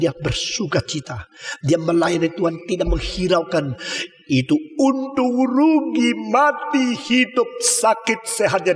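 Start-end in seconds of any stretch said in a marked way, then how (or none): 4.3-8.7 fervent preaching. Acted. Preaching climax.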